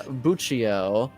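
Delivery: ordinary delivery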